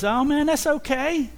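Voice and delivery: high, breezy "it's fine" voice; Moses brushing it off. Pretend. Moses waving off the apology, high and breezy. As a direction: toss it off lightly, way up high. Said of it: "And he says".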